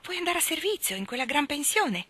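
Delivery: mildly shocked mother, rising high on the question; taken aback, querying a daughter's plan; surprised. A narrator's reading. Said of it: "era evidentemente sorpresa".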